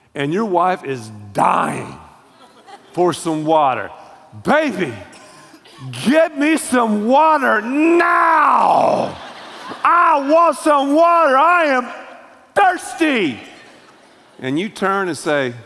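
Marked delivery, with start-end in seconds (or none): 4.4-13.4 demanding with attitude. Acted. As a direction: holler like a spouse dying of thirst. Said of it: "With an attitude like that".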